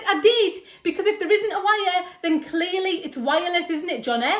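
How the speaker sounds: loud, high-pitched exasperation; exasperated. Acted. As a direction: spell out the obvious, loudly, to a clueless husband. Wife arguing over wireless mouse.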